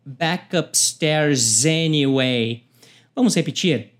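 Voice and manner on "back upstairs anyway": slow "back upstairs anyway" run together; linking demo; linked words. Shown as a demonstration. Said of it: "Então, fica".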